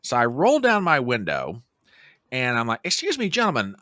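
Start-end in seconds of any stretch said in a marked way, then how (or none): 2.8-3.8 polite approach. Retold or imitated. Asking strangers for directions.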